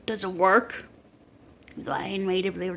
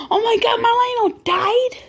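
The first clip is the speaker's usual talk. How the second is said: put-on much higher and louder, a kid's disbelieving panic; shocked outburst; 9 semitones higher, 5 dB louder than usual. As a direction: blurt it out in horrified disbelief. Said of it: "I'm like".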